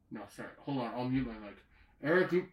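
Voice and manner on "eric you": calling out. Real, not acted. Calling out to Eric.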